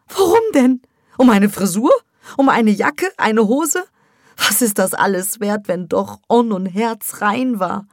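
high, loud, rising questions; agitated mother. Acted. Mother argues with Sevda, high and outraged.

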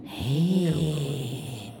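a hint of a sly old witch. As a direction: lure the boy in. Witch luring the boy in dream.

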